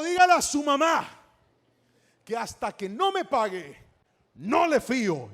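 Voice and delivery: loud, high-pitched scolding shopkeeper; angry refusal. Acted. Shopkeeper refusing boy credit in public, angry. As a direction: send the boy off with a loud rebuke.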